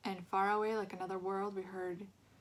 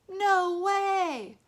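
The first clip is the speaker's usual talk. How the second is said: high shocked outcry; cry out; 11 semitones higher, 13 dB louder than usual. A narrator's reading. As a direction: cry out in disgust. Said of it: "one of the boys cried out".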